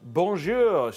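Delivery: slow French bonjour. Shown as a demonstration. Streamer greeting chat with mock French accent. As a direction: drawl bonjour like a Parisian. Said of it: "Say it in a French accent".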